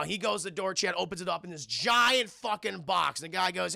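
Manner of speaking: ordinary delivery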